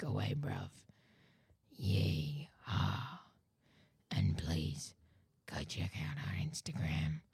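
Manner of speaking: low, whispered shadow serpent; low whispering snake-man signing off; Weros voice. Acted. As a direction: hiss the farewell like a lurking snake. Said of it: "do it in Weros's accent".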